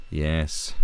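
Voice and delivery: ordinary talk, nothing marked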